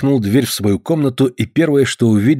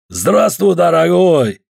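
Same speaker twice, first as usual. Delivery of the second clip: put-on loud, higher greeting in a thick Caucasian accent; strong Caucasian accent; 6 semitones higher, 4 dB louder, much slower than usual. Georgian guest hails the returning host, strong Caucasian accent. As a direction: boom out a welcome with a heavy Caucasian accent. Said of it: "с сильным кавказским акцентом сказал он".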